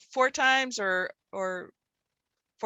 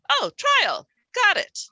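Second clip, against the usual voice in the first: much higher and louder, triumphant; mock eureka; 10 semitones higher, 5 dB louder, slower than usual. Shown as a demonstration. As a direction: act the sudden discovery. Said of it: "eureka moment".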